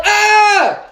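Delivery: high, loud startled shriek; boy yelping when his brother pops out; startled yell. A narrator's reading. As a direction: jump and yell in fright. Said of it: "He scared me so bad I yelled".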